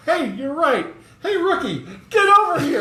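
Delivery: hammed-up cop yelling for the rookie; retold cop beckoning the rookie, laughing; old cop calling rookie. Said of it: "he goes".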